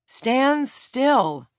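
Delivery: loud high warning shout. A narrator's reading. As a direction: shout them to freeze. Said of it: "Lila shouted".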